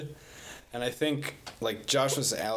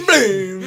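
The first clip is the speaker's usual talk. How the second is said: booming game sound; 6 semitones higher, 12 dB louder than usual. Retold or imitated. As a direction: blast out a game boom. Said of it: "throw all your ninja stars and the game goes".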